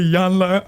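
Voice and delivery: lightly mock the way "Iyanla" was called; mimicked name. Host teases his brother about the name, lightly mimicked "Iyanla". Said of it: "He said".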